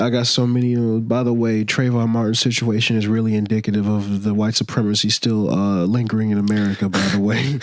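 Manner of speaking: mock rapper voice. Rapper line read in a voice.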